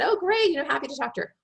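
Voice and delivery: mock-breezy yes, pitched high at the start; cheerful agreement. HR friend agreeing to talk to a candidate, cheerful.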